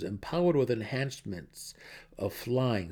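usual speaking voice

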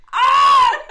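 high, loud mock cry; hammed-up wail of a burdened man; mock anguished scream. [name] walks in with his son, mock anguished scream.

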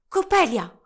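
high, loud call of a name. A narrator's reading. Young woman calling to her rival on a balcony, loud. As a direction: call up to get attention.

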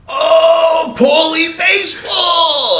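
high, drawn-out holler at an idol; excited fan shout. Retold or imitated. Fan spotting Paul O'Neill on a bench, high excited shout. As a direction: call out loud and stretch it, re-enacting a starstruck fan.

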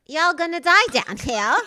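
high, loud mock little girl, British attempt; hammed-up child voice with laughter; little girl accent. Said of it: "I can't do a little girl accent".

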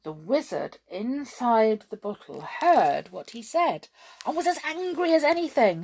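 usual speaking voice

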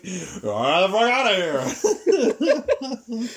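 slow, high roaring wrestler yell; wrestler shout. Retold or imitated. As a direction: bellow it like a brawler throwing people out. Odo throwing fighters out.

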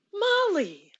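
high, pleading why why why; exasperated pleading at the screen; exasperated plea. Real, not acted. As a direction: beg the character to stop. Scolding TV character.

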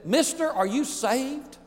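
high, slow, earnest question; lightly voiced young preacher challenging a stranger; young preacher asking the stranger; cowboy preacher. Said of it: "and he said".

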